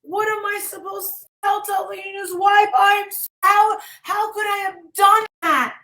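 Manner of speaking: retelling his partner's tearful panic; distraught wail. Retold or imitated. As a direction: wail it like she's falling apart. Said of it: "She's like".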